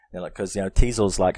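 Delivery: normal talking voice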